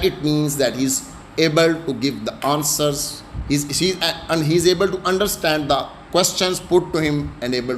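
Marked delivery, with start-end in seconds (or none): none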